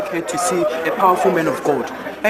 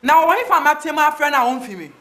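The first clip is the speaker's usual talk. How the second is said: normal talking voice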